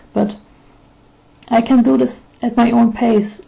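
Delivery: ordinary talk, nothing marked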